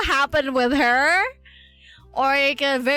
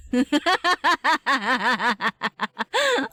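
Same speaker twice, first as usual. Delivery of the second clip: long rippling laugh; 4 semitones higher than usual. Real, not acted. Streamer amused at her own saving vow, laughing. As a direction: let a long giggly laugh run out.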